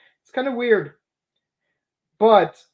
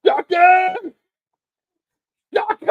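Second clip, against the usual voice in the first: cosplay shout; 11 semitones higher, 6 dB louder than usual. Retold or imitated. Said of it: "I did the same".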